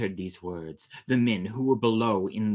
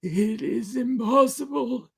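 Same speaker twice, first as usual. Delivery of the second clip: high, loud, slow crying cry of disbelief; weeping protest; 5 dB louder, slower than usual. Acted. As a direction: wail the denial. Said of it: "appeared to weep".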